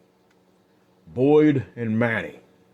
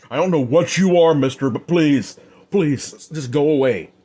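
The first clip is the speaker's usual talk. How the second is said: higher and louder, begging; frightened pleading; 6 semitones higher, 5 dB louder than usual. Acted. Bartender begging the stranger to go, frightened pleading. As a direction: plead with him to leave. Terrified bartender begs Adam.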